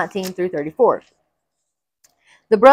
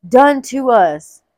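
trembling fear; 4 semitones higher, 5 dB louder, much slower than usual. Acted.

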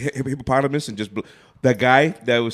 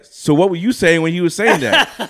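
slow, high, louder imitation of a pressing question; mock questioning; 7 semitones higher, 6 dB louder, slower than usual. Retelling a wife grilling her singing husband, amused mock questioning.

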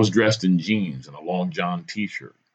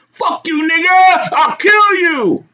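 very high, loud screamed threat; furious screaming; 8 dB louder, slower than usual. A narrator's reading. Madman rejects the plea to calm down, screaming. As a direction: scream back, drawing out the threat.

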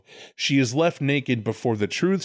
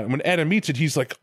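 normal talking voice

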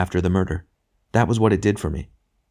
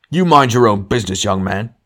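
loud, high start, dropping on the address; indignant rebuke; 4 semitones higher, 7 dB louder than usual. A narrator's reading. Ghost telling off the other, indignant. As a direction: put the upstart firmly in his place.

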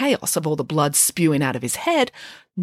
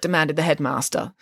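ordinary delivery, nothing marked

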